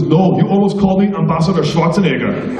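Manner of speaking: mock Austrian-accented retort. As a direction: correct the speaker in an Austrian accent. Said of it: "never quite lost his Austrian accent".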